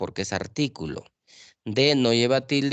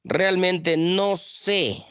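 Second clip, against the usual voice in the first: very slow, higher read of the example; slow example sentence; 6 semitones higher, much slower than usual. Shown as a demonstration. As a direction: read the example slowly, word by word. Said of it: "del verbo saber, tilde". (lectura muy lenta y aguda de "Realmente no sé")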